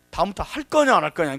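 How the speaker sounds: mock stern loud telling-off; retold scolding. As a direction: loudly demand an answer, imitating the scolding. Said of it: "막 혼내키는 거예요".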